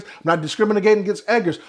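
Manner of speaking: ordinary talk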